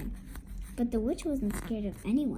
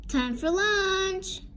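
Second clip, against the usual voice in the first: lightly sung-out, slow lunch call; 7 semitones higher, slower than usual. A narrator's reading. Farmer announces lunch to his family, calling out. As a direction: draw it out, higher than usual.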